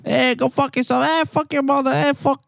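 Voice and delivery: high mock yelling of curses; imitated yelling. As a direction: yell the curses in a high put-on voice. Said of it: "you hear yelling out there".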